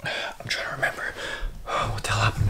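soft unvoiced whisper; hush, barely breathing it. Real, not acted. Whispering after nightmare.

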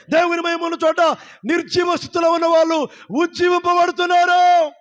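loud and high, proclaiming; fervent preaching. Acted. Pastor proclaiming glory over the listeners' homes, loud fervent preaching. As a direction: declare it over the whole house.

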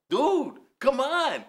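normal talking voice

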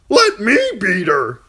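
high, loud, hammed-up fool; eager jester. Fool begging to beat her himself, loud and silly. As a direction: leap in with a clown's eagerness.

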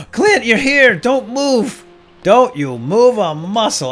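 high, loud delighted shout; loud, drawn-out plea to hold still; excited relief. Real, not acted. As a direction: stop him from closing up. Arrives as shop still open.

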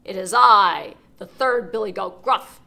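booming big goat voice; loud big goat. Acted. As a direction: answer as loud as the troll. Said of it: "just as loud as the troll's".

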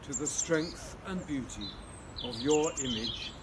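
solemn spoken prayer. Acted. Leads an opening prayer.

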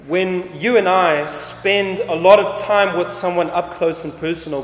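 ordinary talk

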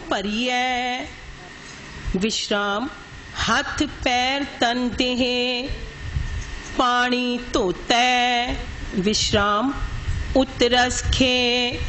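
slow, measured recitation with drawn-out endings; scripture recitation. Shown as a demonstration. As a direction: recite phrase by phrase, pausing at each marked rest. Teacher recites scripture phrase by phrase. (ਠਹਿਰ ਠਹਿਰ ਕੇ ਗੁਰਬਾਣੀ ਪਾਠ)

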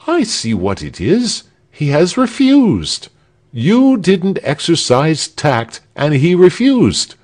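lightly voiced smug townsman lecturing the others; lecturing know-it-all. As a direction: lecture them on tact, then sulk. Arnold of Sewa, smug again.

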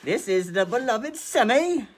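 high, loud mock-British introduction; attempted British accent. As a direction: announce the cat in over-the-top British. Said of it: "that accent you're doing".

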